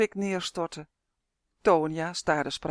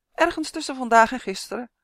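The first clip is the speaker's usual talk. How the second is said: higher, louder little elf voice; lisping elf; 7 semitones higher, 5 dB louder than usual. A narrator's reading. Uf answers Tonia about the others, small high elf voice. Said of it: "lispelde Uf".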